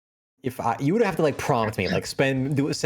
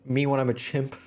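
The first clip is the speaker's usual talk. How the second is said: ordinary delivery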